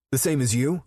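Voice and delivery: high, loud, spitting it back; teen spitting back at the older man; spat. A narrator's reading. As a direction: snap back defiantly at Rip. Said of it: "Boston spat".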